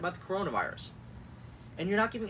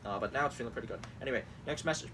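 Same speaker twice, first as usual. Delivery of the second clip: ordinary delivery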